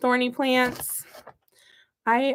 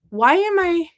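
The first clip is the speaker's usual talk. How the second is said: higher-pitched self-questioning; mock exasperation; 7 semitones higher, 4 dB louder than usual. Acts out her exasperated past self.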